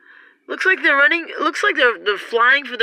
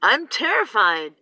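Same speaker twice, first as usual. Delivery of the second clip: normal talking voice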